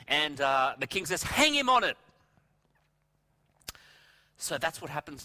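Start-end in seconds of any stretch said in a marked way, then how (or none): 1.2-2.0 high, loud royal order; shouted command. Acted. The king ordering Haman's hanging, shouted command. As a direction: call out the sentence as an acted king.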